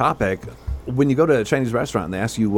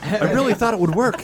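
lightly imitated, higher-pitched movie dork; Napoleon Dynamite; 10 semitones higher than usual. Riffing on the time machine scene, mild movie impression. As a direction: pitch it up like a hapless film nerd. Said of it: "It's like Napoleon Dynamite".

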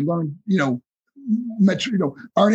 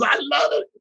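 Dusty Rhodes; 9 semitones higher than usual. Retold or imitated. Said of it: "He goes".

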